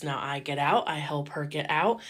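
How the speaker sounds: ordinary talk, nothing marked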